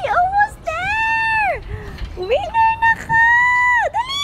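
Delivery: excited parent cheering at the finish; thrilled cheering. Real, not acted. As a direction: scream her over the finish line. Child about to win race.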